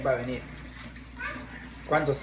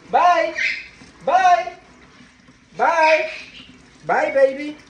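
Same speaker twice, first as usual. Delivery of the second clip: loud, high call; calling goodbye; 7 semitones higher, 5 dB louder, much slower than usual. Real, not acted. Owner prompting the parrot to say goodbye, high loud calling. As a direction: call out a high, bright-pitched goodbye to a pet.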